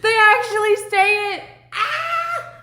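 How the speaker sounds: high, loud thrilled shout, breaking into a laugh; overjoyed outburst at hearing it for real; excited shout. Retold or imitated. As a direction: shriek it like you can't believe your ears. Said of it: "and I'm like".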